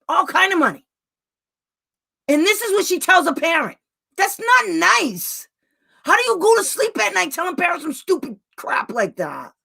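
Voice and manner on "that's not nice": normal talking voice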